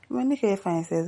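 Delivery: ordinary delivery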